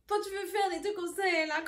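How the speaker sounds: high laughing, barely getting the words out; cracking up mid-sentence; laughing while telling. Real, not acted. Telling her friends about her sister, laughing.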